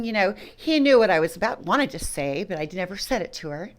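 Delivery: ordinary talk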